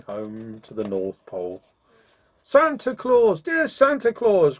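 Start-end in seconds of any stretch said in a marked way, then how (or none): 2.4-4.6 high, loud, desperate call; pleading cry. A narrator's reading. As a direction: cry out for help, pitched well up. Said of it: "cried Jimmy Scarecrow with a great sob".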